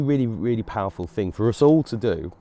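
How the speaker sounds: usual speaking voice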